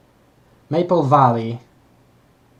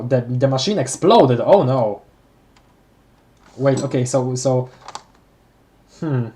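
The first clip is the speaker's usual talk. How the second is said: ordinary delivery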